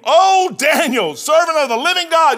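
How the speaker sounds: high, loud anguished call down into the den; lamenting cry. Acted. King crying to Daniel at the den, high loud lament. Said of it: "cried with a lamentable voice".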